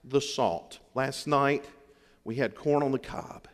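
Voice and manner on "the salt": slow, gentle "please pass the salt"; polite request. Retold or imitated. Husband at table, asking his wife gently. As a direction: ask your wife softly and slowly. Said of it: "look over at your wife and say".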